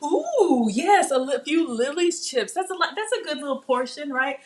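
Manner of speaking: high, delighted food talk; light up over the chips; excited. Real, not acted. Excited about chocolate chips.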